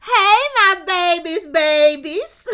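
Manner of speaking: high, loud, slow singsong greeting; mock mama greeting. Mama bursting into the classroom, gushing loud and high. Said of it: "She said".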